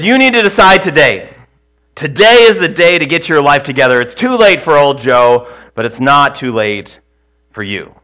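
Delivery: loud, high warning, slowing on the last words; preacher shouting. Acted. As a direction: hammer home the warning, then drop. Funeral preacher's warning retold.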